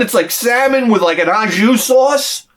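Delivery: ordinary delivery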